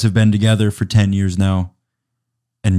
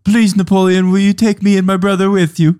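put-on higher, louder child's voice begging; a kid pleading to go along; 10 semitones higher, 4 dB louder than usual. Child begs Napoleon to take him along, in a high pleading voice.

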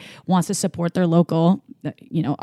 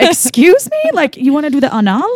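high, loud mock shock; 6 semitones higher, 4 dB louder than usual. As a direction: act scandalized and incredulous. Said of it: "And I would be like".

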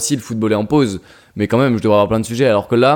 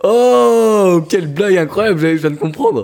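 high, loud sarcastic oh; mock amazement; 8 semitones higher, 3 dB louder, slower than usual. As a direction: overplay being dazzled by the joke. Mock amazement at a bad joke.

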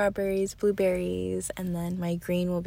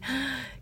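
sharp acted gasp for air; short mock gasp of being overwhelmed; breathless gasp; 5 semitones higher, 5 dB quieter than usual. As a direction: mimic gasping for breath. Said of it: "can't catch my breath".

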